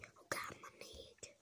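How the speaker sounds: usual speaking voice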